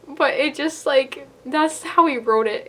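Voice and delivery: usual speaking voice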